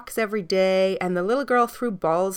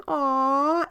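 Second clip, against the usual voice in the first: put-on 1 children cooing; 7 semitones higher than usual. A narrator's reading. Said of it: "all the children saying".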